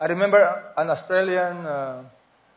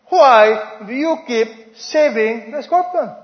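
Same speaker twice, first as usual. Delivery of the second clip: questioning monk; 5 semitones higher, 6 dB louder, much slower than usual. Acted. Other monk questions him.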